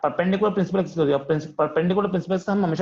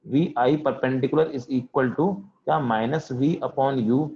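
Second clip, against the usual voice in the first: each word of the formula spoken very slowly to the class; slow dictation; much slower than usual. Shown as a demonstration. Teacher dictating a formula to the class, very slow. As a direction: spell the formula out slowly so students can write.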